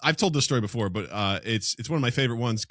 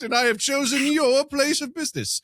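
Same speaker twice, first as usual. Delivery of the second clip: mock formal; 6 semitones higher, 4 dB louder than usual. Mock stiff formal job request.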